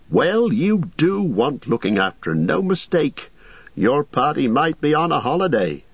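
innkeeper Butterbur. Acted. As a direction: chatter like a nervous landlord.

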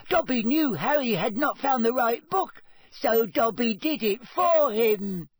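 squeaky, high-pitched insistent elf; Dobby. A narrator's reading. Elf insisting Harry can do the task, squeaking. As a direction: squeak it with utter conviction. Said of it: "squeaked the elf".

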